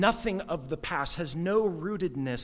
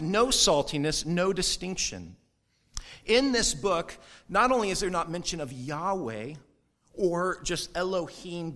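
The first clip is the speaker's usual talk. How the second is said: normal talking voice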